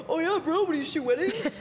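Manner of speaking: hammed-up bro asking another guy. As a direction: play a loud dude bro. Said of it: "When a guy says".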